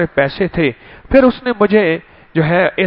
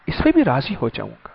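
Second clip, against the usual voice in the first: softer and quicker, dropping away; soft repeat; 5 semitones lower, 9 dB quieter, much faster than usual. Shown as a demonstration. Preacher echoing God's promise softly to congregation. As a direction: let the promise fall soft and fast.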